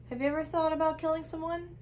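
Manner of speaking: sudden eager question. Retold or imitated. Husband springs a question on his wife, sudden and high. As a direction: blurt it out fast and high, imitating him.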